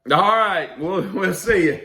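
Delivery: high-pitched brush-off from a crowd; mock crowd reply. Crowd to the bare-faced band, mock high brush-off. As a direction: send them off in a high voice. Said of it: "everybody's like".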